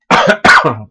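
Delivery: toux forte et rauque; quinte de toux. Real, not acted. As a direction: tousser fort, la gorge irritée. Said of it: "Désolé, je tousse". (toux sèche et bruyante)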